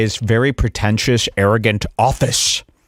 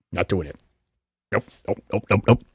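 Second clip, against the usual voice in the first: mock shutdown; 4 semitones lower, 5 dB quieter, faster than usual. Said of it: "and I go".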